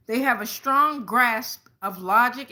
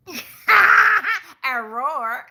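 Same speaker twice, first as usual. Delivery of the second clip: laughing roar; 5 semitones higher, 9 dB louder than usual. Real, not acted. Leo reader hypes the roar.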